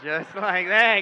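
big slow shout with a laugh; loud slow triumphant. Shown as a demonstration. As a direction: bellow it like a reveal. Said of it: "pull out the egg".

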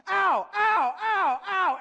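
loud, high "ow" yelps; mock pained yelps. Acting out wives plucking their eyebrows, mock pained yelps. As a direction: yelp in sharp pain, hammed-up.

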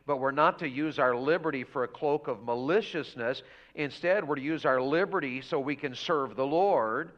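usual speaking voice

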